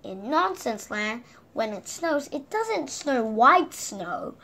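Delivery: ordinary delivery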